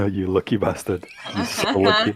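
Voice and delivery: laughing through the words; amused, laughing exclamation at a player's luck. Real, not acted. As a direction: chuckle while calling out their luck. Game master laughs at lucky roll.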